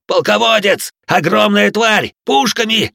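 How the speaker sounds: desperate high screaming alarm; frantic shout. A narrator's reading. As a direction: scream the warnings in panic. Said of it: "раздался истошный крик с улицы".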